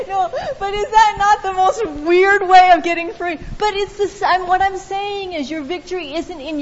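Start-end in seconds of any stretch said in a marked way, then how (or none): 0.0-3.4 laughing through the words; real laughter breaking in. Real, not acted. Laughing at own confession.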